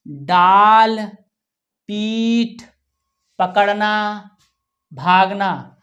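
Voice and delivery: ordinary delivery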